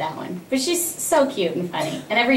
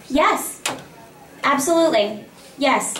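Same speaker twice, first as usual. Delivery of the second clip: eager acceptance; 4 semitones higher than usual. Retold or imitated. Actor answers character's declared love.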